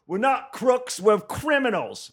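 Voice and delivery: high-pitched B-movie Australian heckler; badly faked Australian accent, drawn out. Australian heckler yells back at comic, bad Australian accent. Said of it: "like a B movie Australian accent".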